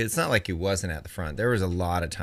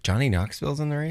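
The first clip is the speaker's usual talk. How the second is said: higher, quicker mock yell; mock disbelief, pitched up and rushed; imitating screaming wife; 7 semitones higher, faster than usual. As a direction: imitate an incredulous yell without real volume. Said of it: "My wife was screaming at the T V".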